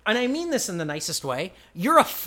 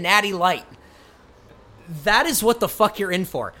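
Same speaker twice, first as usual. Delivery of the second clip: emphatic, rolling promo list; announcer-style read; 3 semitones higher than usual. Acted. Listing a wrestler's career to sell the show, emphatic announcer read. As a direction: hit each credit like a hype announcer.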